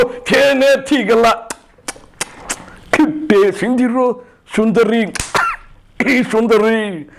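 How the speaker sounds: high, very slow grandiose declamation; stretched theatrical oratory, pitched high; Rama Rao. Acted. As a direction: mimic an old-time star orating the lyrics. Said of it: "Ramarao గారు".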